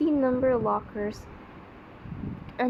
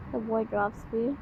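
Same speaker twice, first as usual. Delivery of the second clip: ordinary talk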